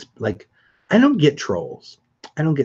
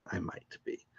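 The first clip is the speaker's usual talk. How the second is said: a teasing whispered repeat, much softer; 5 semitones lower, 20 dB quieter than usual. Real, not acted. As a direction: whisper it like a secret hint. Teasing repeat of the same words.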